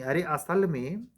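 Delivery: ordinary talk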